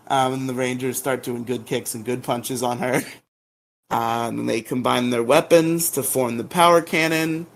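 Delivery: normal talking voice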